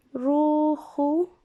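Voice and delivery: drawn out syllable by syllable; slow dictation. Shown as a demonstration. Teacher to class while writing, slowly drawn out. Teacher writes word on board.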